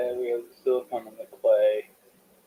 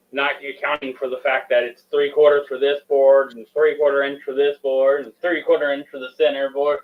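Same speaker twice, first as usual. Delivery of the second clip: ordinary talk, nothing marked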